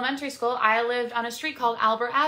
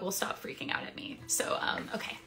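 unvoiced aside to herself; hushed whisper about the dog; 3 semitones lower, 9 dB quieter than usual. Real, not acted. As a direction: whisper it under your breath.

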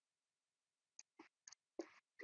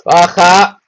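urgent call; 5 semitones higher, 8 dB louder than usual. Real, not acted. Urging dragon to come down.

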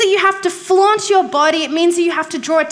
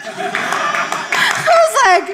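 laughing; 10 semitones higher, much slower than usual. Real, not acted. Laughing while retelling dad story.

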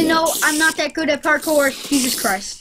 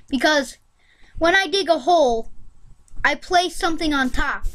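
ordinary talk

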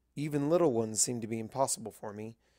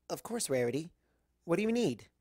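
Twilight's voice; 5 semitones higher than usual. Acted. Twilight answering Rarity, lightly voiced and wary. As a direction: answer politely, a little wary.

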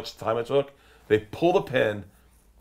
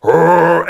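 loud, raised bear roar; imitated grizzly roar; 7 semitones higher, 15 dB louder than usual. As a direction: drown everyone out with a roar. Imitating the nine-foot grizzly.

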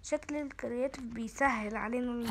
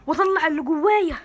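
louder, pumped-up declaration; fired-up vow; 3 semitones higher, 11 dB louder than usual. Real, not acted. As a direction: hype yourself up. Pumping himself up. (حماس وإصرار بصوت عالي)

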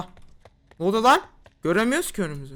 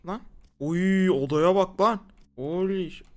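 ordinary talk, nothing marked

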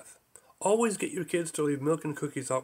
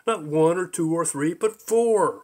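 angry shout; 2 semitones higher, 6 dB louder, slower than usual. Acted. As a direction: shout it, furious. Zorg's angry shout continues.

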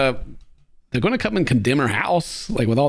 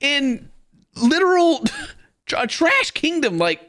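much higher, aghast church-lady voice; mock shocked helper; 11 semitones higher than usual. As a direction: play the horrified visitor, hammed-up. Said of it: "She said".

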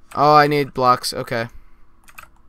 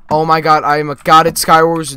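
loud, amazed bragging; exultant boast; 3 semitones higher, 11 dB louder than usual. Real, not acted. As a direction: shout it in triumph. Exults after a win.

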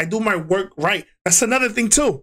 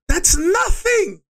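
slow, much higher shout; angry shout; 11 semitones higher, much slower than usual. Retold or imitated. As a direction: yell it out slowly in disbelief. Angry rant at district manager.

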